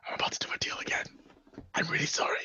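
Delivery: soft unvoiced whisper. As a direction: whisper an apology aside. Aside to ally, whispering.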